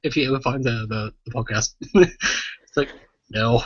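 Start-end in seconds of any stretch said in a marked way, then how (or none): none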